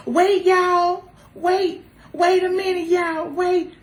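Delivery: high, loud flustered cries of wait; pretend panic at the rope. She pictures herself stuck at the turning ropes, flustered. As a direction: act out freezing before jumping in.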